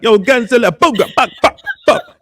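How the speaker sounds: mock rant, high, loud and garbled; hammed-up furious girlfriend losing it; angry Suzette. Guest mimics Suzette's accent exploding at a man, mock fury. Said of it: "that accent come out, run".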